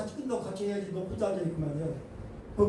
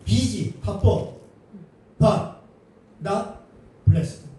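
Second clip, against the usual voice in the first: But, not and blessed said one by one, louder and apart; spelled-out motto; 4 dB louder, much slower than usual. Shown as a demonstration. Preacher spelling out a motto to the congregation, word by word and loud. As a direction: punch each word of the motto. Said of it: "영어로 비비엠비".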